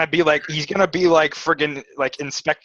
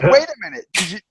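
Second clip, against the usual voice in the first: higher, incredulous question; mock surprise; 7 semitones higher than usual. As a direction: act out the companion catching on. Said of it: "She'll be like".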